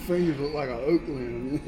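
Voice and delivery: normal talking voice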